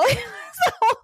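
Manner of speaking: high, laughing so hard it squeals; real laughter. Real, not acted. She recalls the officer waving, cracking up. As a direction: barely get it out through giggles. Teller cracks up at own story.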